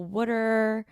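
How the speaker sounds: Philly WOODER for water, first vowel as in wood; mock Philly accent on wooder. Shown as a demonstration. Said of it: "I don't have the whole wooder".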